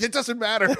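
mock husband. Host imitates husband.

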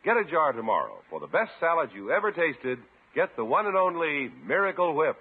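ordinary delivery

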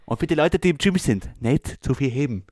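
playful Tyrolean farewell; Tyrolean accent. Acted. Co-host bidding listeners goodbye, Tyrolean accent. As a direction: wish them well in Tyrolean. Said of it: "noch, noch unser Tiroler".

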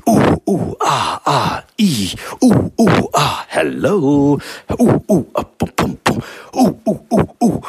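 high hoots mixed with test vowels and pops; testing different frequencies. Retold or imitated. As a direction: try silly sounds on the wall's echo. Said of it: "testing it with different frequencies".

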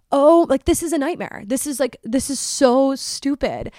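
high, hammed-up lament; comic despair, voice lifted; exasperated dismay. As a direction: moan it with dramatic embarrassment. Reliving the nightmare of being exposed.

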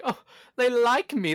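ordinary talk, nothing marked

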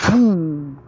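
high loud boom; boom sound effect. Pretend. Boy mimicking his fastball hitting dad, high loud boom. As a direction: punch out the boom of the throw.

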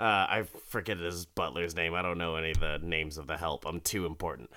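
ordinary delivery

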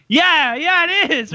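a squeaky mock Dan, high and bright-eyed; imitating Dan. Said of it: "And then Dan's like".

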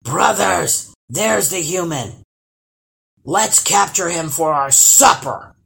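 troll calling out loudly to the others; loud troll rallying cry; hollering troll. A narrator's reading. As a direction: holler it, landing hard on the last words. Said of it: "hollered the first troll".

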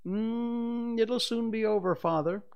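put-on high, sing-out cheery boy; high-pitched cheerful reassurance. A narrator's reading. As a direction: cheer him on in a high child's voice. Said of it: "John said cheerily".

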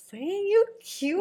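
high, slow admiring coo; flirty self-compliment. Retold or imitated. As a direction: flirt with the mirror. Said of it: "I'm like".

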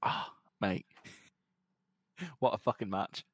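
ordinary talk

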